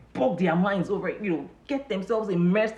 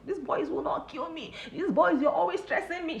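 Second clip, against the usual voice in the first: worried mother; 10 semitones higher than usual. Retold or imitated. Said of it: "She shouts every day".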